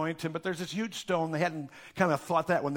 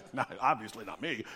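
smug denial; 7 semitones lower, 6 dB quieter than usual. Retold or imitated. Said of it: "Peter said".

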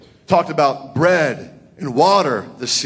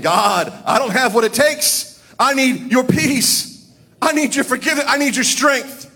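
desperate plea to God; 9 semitones higher than usual. Retold or imitated. Preacher recalls crying out to God, much higher, pleading. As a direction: cry out to God like a man at the end of his rope.

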